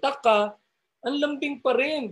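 sweet sing-song Ilonggo tune, rising high; Ilonggo lilt. Retold or imitated. Preacher imitating quarrelling Ilonggos in their sweet lilt. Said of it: "Ang sarap gayahin ng kanilang tonality".

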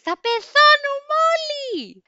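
very high, loud, drawn-out mock shriek; imitated panicked screech. Passenger behind screaming as engines fail, acted high screech. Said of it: "άρχισε να τσιρίζει".

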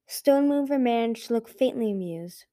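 ordinary delivery, nothing marked

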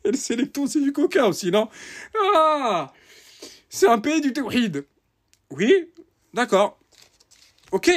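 high, mocking incredulity; sarcastic disbelief. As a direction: pretend to believe it, sarcastically. Sarcastic mock of the claim. (incrédulité sarcastique et aiguë)

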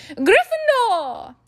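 slow, high, booming shout; hat shouting. A narrator's reading. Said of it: "the hat had shouted".

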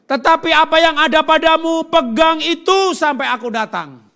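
very loud, high preacher's proclamation; full-force, drawn-out scripture reading; proclaimed scripture. Acted. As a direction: thunder the verse out to the congregation. Reads verse aloud forcefully.

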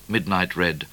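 usual speaking voice

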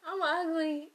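high, loud mock announcement; exaggerated self-declared ugliness. Blurting into a silent room, exaggerated mimicry. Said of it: "that ugly person goes".

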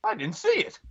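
high, squeaky Muppet imitation. Imitating a friend's line as a high Muppet squeak. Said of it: "I like the way he went like this".

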